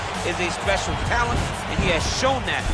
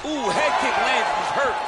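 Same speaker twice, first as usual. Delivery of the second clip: excited commentator; 4 semitones higher than usual. Real, not acted. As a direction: react to the big kick. Commentator reacting to knockdown.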